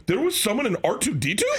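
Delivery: astonished, high-pitched disbelief; incredulous question. Retold or imitated. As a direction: question it like your mind is blown. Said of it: "Are you serious?".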